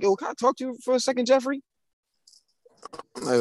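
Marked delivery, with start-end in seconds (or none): 0.0-1.6 higher, quick teacher request; lightly voiced teacher, raised pitch; imitated teacher. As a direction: mimic a teacher asking for a word, pitched up. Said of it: "She walk in like".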